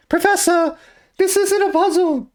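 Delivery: put-on squeaky high young boy. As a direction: pitch way up like a kid. Acting Luke in skit.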